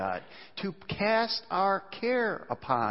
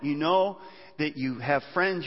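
usual speaking voice

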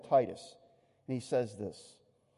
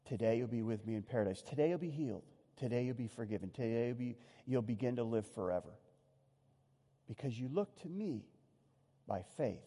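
soft, lower, hushed reassurance; tender promise; 7 semitones lower, 8 dB quieter than usual. Retold or imitated. Jesus comforting the dying criminal, soft tender promise. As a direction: promise it gently, almost to yourself.

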